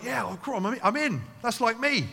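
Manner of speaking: preacher voicing an eager listener, mock-enthusiastic; eager crowd member. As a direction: play a listener who is instantly hooked. Farmers voiced eagerly agreeing.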